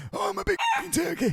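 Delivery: very high, squealing character voice; hammed-up high-pitched impersonation; comic impression. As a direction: wail it in a silly falsetto. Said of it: "an impression I can do".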